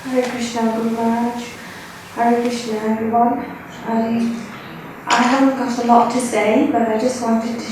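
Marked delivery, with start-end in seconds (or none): none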